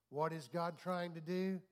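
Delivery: ordinary talk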